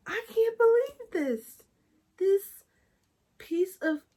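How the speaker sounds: very high, drawn-out mock wail; imitated crying. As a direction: act out bawling in disbelief, high and loud. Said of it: "so I'm crying".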